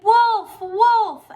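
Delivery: high, loud cry of alarm; shouting. A narrator's reading. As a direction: shout it out to the whole village. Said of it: "He shouted".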